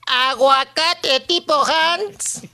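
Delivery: slow drawn-out vendor call; market vendor shout. Retold or imitated. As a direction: sing it out like a market stall. (pregón lento de vendedor)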